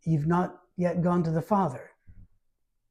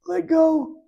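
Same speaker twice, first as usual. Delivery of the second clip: much higher and louder burst; urgent let go; 12 semitones higher, 5 dB louder than usual. Acted. Jesus telling Mary to loosen her fierce hug, loudly. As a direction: cry it out as if squeezed in a fierce hug. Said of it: "This is a fierce hug".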